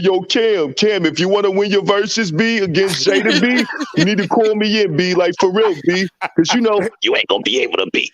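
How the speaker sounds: excited hype-man pitch; hyped rapper's plea. Acted. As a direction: pitch yourself up and talk Cam into it. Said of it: "I should have hit him up and be like".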